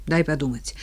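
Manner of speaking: ordinary delivery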